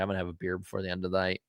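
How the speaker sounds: fast, wired rush of words. Real, not acted. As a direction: rattle it off, wired up. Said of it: "'cause I'm wired right now".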